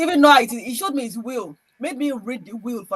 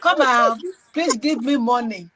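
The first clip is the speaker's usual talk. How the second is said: cheeky begging; 8 dB louder than usual. Retold or imitated. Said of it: "I say".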